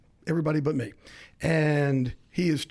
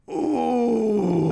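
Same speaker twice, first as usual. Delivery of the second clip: high, loud teasing "ooh" from the guys; mock crowd ooh; 7 semitones higher, 4 dB louder than usual. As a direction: hoot it like teammates egging on a fight. Teammates react to the insult.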